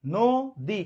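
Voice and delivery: নদী slowly split as "no - di"; syllable by syllable. Shown as a demonstration. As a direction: break নদী into two held syllables with a gap. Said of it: "কয়বার উচ্চারণ করলাম?". (নদী দুই ভাগে, ধীরে ধীরে)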